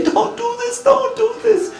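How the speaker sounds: very high-pitched bystander cry; high, pleading mock alarm; imitated alarmed warning. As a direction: mimic onlookers warning from the bank. Bystanders on the bank warn him.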